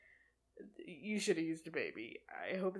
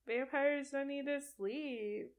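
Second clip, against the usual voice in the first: mock surprised Bella; 6 semitones higher, slower than usual. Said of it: "she's all like".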